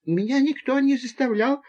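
put-on high, small boy's voice; timid babble. A narrator's reading. Borya answering the adults about the snake, timid babble. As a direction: squeak it out meekly and high. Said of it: "пролепетал Боря".

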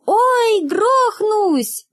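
high, loud, crowing squawk; falling girl squawks in a rooster voice; rooster-like cry. A narrator's reading. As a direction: shriek it out like a rooster while falling. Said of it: "и кричит по-петушиному".

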